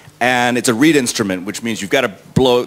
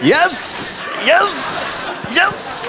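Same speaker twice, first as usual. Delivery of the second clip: high, gleeful repeated cry; hammed-up victory cheer; triumphant; much slower than usual. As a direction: celebrate each one like a win. Retold triumph at fan's remark.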